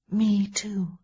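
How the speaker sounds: drowsy whisper; half-asleep murmur; sleepy. Acted. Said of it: "she said sleepily".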